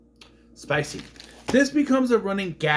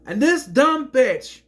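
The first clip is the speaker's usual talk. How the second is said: ordinary delivery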